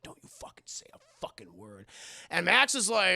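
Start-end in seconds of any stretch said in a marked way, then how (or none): 0.0-1.8 much lower and much softer, hushed menace; low menacing threat. Retold or imitated. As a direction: threaten her under your breath. Esther threatens Max silently.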